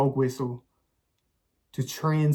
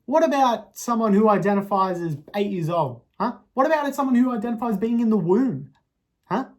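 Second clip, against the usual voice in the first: higher and louder indignant questions; hammed-up outrage; 8 semitones higher, 7 dB louder than usual. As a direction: demand it, full of fake righteousness.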